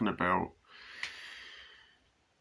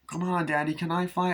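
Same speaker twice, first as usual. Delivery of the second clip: put-on high pleading child voice; little son asking dad to fly the kite; kid voice; 7 semitones higher than usual. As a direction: beg like a small boy. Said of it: "he's like".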